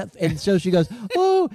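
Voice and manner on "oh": a high, cheery oh like a cashier. Retold or imitated. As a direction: chirp it brightly like a cheerful cashier. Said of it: "they're all cheery".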